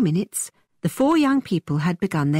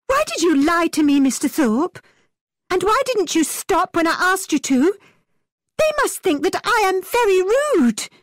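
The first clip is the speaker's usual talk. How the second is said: angry reproach; 11 semitones higher than usual. A narrator's reading. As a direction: scold him with hurt anger. Catherine angry.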